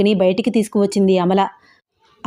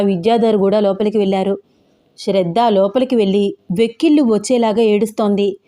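usual speaking voice

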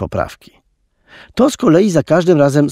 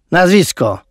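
roared; 5 semitones higher than usual. A narrator's reading. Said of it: "ryknął".

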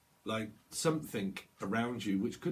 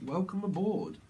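mock flight attendant; 8 semitones higher than usual. Mimicking airline greeting.

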